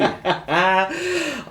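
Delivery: high burst of laughter; amused laugh. Real, not acted. Player amused at calls to get her, laughing. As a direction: laugh out freely at the joke.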